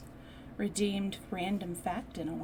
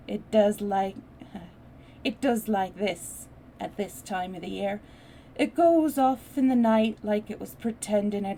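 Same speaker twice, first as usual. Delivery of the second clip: put-on Yorkshire accent; 8 dB louder than usual. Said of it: "I'm trying to get my Yorkshire dialed in".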